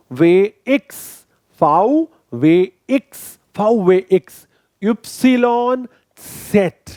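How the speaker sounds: slow, spelled-out German letter names; German alphabet drill. Shown as a demonstration. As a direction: say each letter clearly for the class to repeat. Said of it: "साथ में बोलते रहिए".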